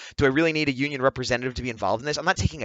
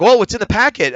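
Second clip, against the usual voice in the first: higher, louder imitation of staff; mock officious; 8 semitones higher, 9 dB louder than usual. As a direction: parrot a rule-bound official. Mimics rule-bound staff.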